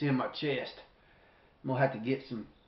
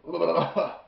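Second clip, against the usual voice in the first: quick hacking cough; sharp cough; 5 semitones higher than usual. Real, not acted. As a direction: cough from throat burn. Coughing from hot pepper.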